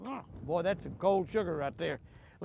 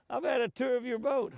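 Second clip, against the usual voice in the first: fish voice; 9 semitones higher than usual. Pretend. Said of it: "Fish says".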